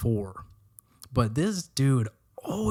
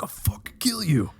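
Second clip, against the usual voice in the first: unvoiced, higher mock threat to soap; mock whispered threat; 8 semitones higher than usual. Cursing the soap that fell, a mock whispered threat. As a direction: hiss a playful death threat.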